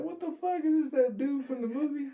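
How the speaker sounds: very high, squealed retold disbelief; bewildered. Waking beside the clucking mohawk man, bewildered. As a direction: squeal it as if waking to a bizarre sight.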